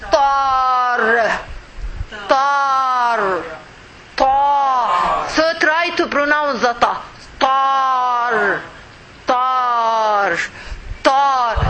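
tar said heavy, the r held with no vowel; heavy tar with sukun. Shown as a demonstration. Teacher drilling the letter with sukun. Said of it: "Let us put sukun here".

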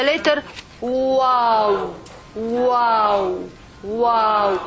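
"Wow" said very slowly with rounded lips; slow, round-lipped "Wow"; rounded Waw. Shown as a demonstration. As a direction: drag out "Wow", mouth in a circle. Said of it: "make your mouth like the circle".

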